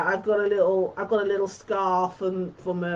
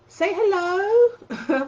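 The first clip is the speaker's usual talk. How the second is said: high slow sing-song hello; drawn-out high greeting; 8 semitones higher, much slower than usual. Real, not acted. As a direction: coax a hello sweetly. Greets a viewer.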